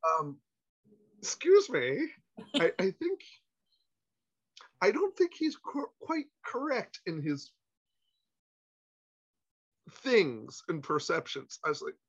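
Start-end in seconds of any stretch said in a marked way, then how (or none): 1.2-11.6 high, slow, fussy objection; prim inner voice. Retold or imitated. Cautious part of his brain objecting, prim and slow. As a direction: pompously correct the idea. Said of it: "the other part is going".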